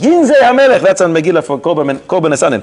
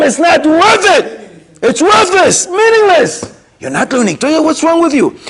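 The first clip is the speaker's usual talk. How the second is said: emphatic rebuke; 10 semitones higher than usual. Acted. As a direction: drive the point home hard. Driving home Reb Chaim's rebuke.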